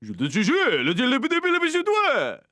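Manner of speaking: high, loud fake French babble; mock French gibberish. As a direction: squeal nonsense French syllables in a silly high voice. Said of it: "And he's like".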